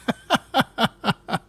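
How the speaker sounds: sudden high burst of laughter. Real, not acted. Laughs at finding the diary.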